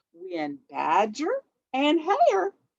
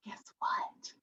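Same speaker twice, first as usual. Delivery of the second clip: whisper; 12 dB quieter than usual. Acted. Whispered aside.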